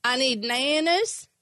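a hint of a little Kentucky boy's drawl; mock Kentucky toddler. Wife's friend retelling, mimics the toddler in a mild Kentucky accent. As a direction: lightly copy the toddler's twang. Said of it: "that's how I spoke the rest of the day".

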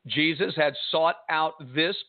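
ordinary delivery

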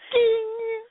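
high-pitched ringing ding; microwave ding. Retold or imitated. Friend teasing about the dial, high imitated ding. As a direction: sing out a timer's ding, way up high. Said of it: "I can only, uh".

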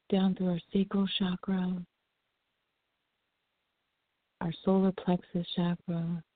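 calm them down breath by breath; soothing massage murmur. Acted. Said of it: "just a relaxing breathing".

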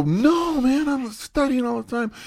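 high, strained, defensive whine; frustrated protest. Retold or imitated. Answering his tough instructor, a high strained protest. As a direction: squeak it out like a flustered student. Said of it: "and I go".

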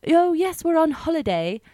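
high, put-on British-Irish lilt; British-Irish accent. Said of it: "his accent was kind of like a mishmash".

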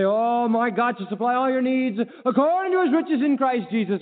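high-pitched pious enthusiasm, put on; congregation's showy profession. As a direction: proclaim the promise with mock fervour. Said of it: "We say".

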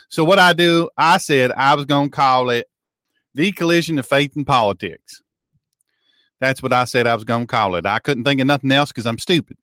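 hammed-up Southern yokel telling a tall tale; folksy Southern simpleton. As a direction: play a bewildered country bumpkin on the radio. Puts on folksy Southern character.

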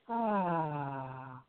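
long soft exhaled ah; sighing ahh. Shown as a demonstration. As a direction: let the breath out on a soft ah.

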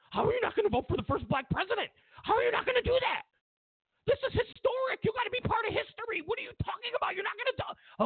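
very high, rapid mock outrage; mock indignant pestering. Pushy friend badgering an undecided Obama voter, mock-indignant squeal. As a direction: squeal in disbelief like a pushy nag. Acted pressuring of a reluctant voter.

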